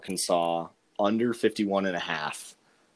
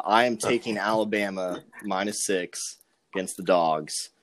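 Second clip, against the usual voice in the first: ordinary delivery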